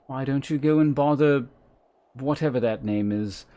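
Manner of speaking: brusque, formal fantasy character voice; put-on character, mildly exasperated; put-upon camp servant. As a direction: read it as someone curtly dismissing a question. Reads another game character's dialogue.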